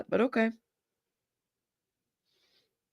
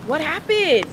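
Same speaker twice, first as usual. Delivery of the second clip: surprised; 8 semitones higher than usual. Real, not acted. Reacting to news.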